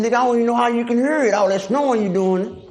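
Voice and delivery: fast, high mocking retort; sneering at the snorer who complains about noise; incredulous put-down. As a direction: rattle it off in disbelief. Mocking the snoring cellmate.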